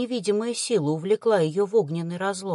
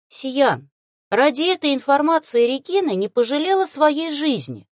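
raised-pitch, mildly apologetic explanation; 5 semitones higher, 4 dB louder than usual. A narrator's reading. Woman explains to offended Sian, apologetic. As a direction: explain yourself with a touch of apology. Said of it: "с извиняющимися нотками в голосе".

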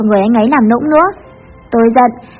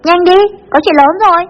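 high-pitched, mildly raised urgent call; urgent louder call; 7 semitones higher than usual. A narrator's reading. Friend calling the boss about big trouble, urgent call. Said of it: "Tiếng gọi lần này to hơn".

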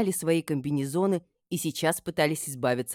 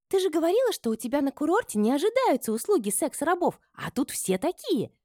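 very high, gleeful chatter; joyful chirping; 13 semitones higher, 3 dB louder than usual. A narrator's reading. Friend teases mistress about her resort, chirping joyfully. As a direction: chirp with delight. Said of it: "радостно щебетала Табита".

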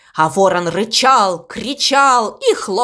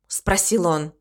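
plain reading voice

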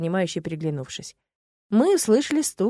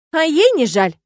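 snorting tease; 9 semitones higher, 10 dB louder than usual. A narrator's reading. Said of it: "фыркнув, ответила за меня Стелла".

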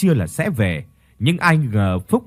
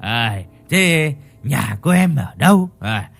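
giọng say chậm, lè nhè; kéo dài chữ như người say; frat bro; slower than usual. Acted. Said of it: "lè nhè nói". (chàng trai say lè nhè trêu cô gái)

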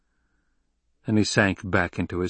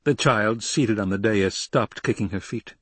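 plain reading voice

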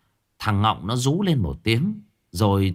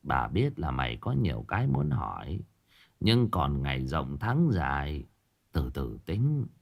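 put-on old grandmother; 5 semitones lower, 5 dB quieter than usual. Old woman Vân speaks.

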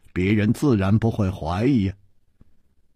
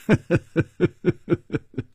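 a short, falling rueful chuckle; wry laugh; 5 semitones higher than usual. A narrator's reading. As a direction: laugh bitterly at yourself. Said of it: "楚留香苦笑着说".

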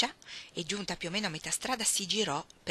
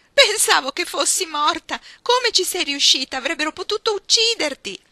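much higher, much louder tearful outburst, slowed; bursting into tears; 10 semitones higher, 13 dB louder than usual. Acted. Ruth sobbing at Iggy after the bees, tearful loud outcry. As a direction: wail it out in relief and fright. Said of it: "scoppiò in lacrime".

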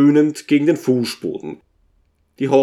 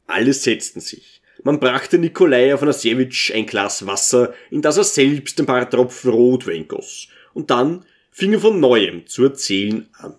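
plain reading voice

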